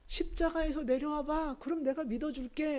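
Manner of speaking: high, lightly teasing imitation; mocking taunt. Crowd daring Jesus to come down, mocking. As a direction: dangle the promise as a taunt. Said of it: "하고 지금 조롱하고 있어요".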